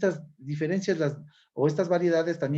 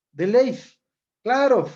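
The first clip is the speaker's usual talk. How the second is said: louder Quito accent, an F on the word endings; Quito final F; 7 semitones higher, 7 dB louder than usual. Shown as a demonstration. Teacher imitating quiteños for students, Quito accent with final F. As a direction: end each word on an F like a quiteño. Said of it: "el quiteño habla con la F".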